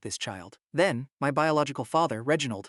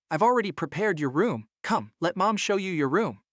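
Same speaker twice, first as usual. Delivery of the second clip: higher, louder motherly coaxing; forced friendliness; 4 semitones higher, 4 dB louder than usual. A narrator's reading. Mother urging her returned son to see his room, forced friendliness. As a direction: coax through a stiff smile. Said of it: "She forced a stiff smile".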